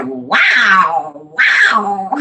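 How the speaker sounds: imitating a baby's long wildcat yowl; wildcat-like baby wow. Grandmother imitates her grandson's wow to viewers, wildcat-like. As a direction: stretch wow into a swooping little yowl. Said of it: "He sound like a wildcat".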